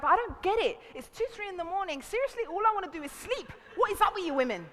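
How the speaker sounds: high-pitched mock exasperation; baffled complaint. Men complaining about being woken at night, exasperated. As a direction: imitate men protesting in bewilderment.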